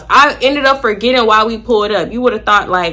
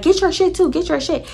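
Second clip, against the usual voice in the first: high quoted demand; 8 semitones higher than usual. Retold or imitated. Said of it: "Jaleesa was like".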